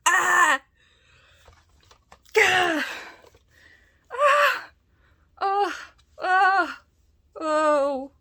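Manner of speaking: high, very loud delighted shrieks; excited screams. Real, not acted. Shrieking in delight at the gift from a friend. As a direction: scream with pure joy.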